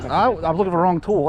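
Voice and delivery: ordinary delivery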